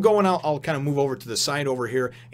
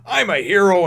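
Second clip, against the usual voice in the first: boastful shout; 7 semitones higher, 8 dB louder than usual. Retold or imitated. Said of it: "he's like".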